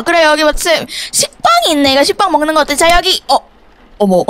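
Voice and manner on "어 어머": ordinary talk, nothing marked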